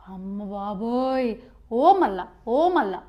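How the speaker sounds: low, slow, hushed mock dread. Student fearing the gods will deny marks, hushed mock dread. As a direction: gasp it out in comic terror. Panics again, lower and slower.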